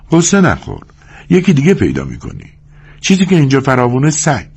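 high-pitched, comforting woman's voice; consoling young woman. A narrator's reading. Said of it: "زن دلداریش داد".

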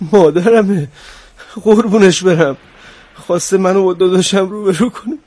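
loud, high, emotional outpouring; choked up. Acted. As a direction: overcome with love for mother. Moved on hearing of his mother.

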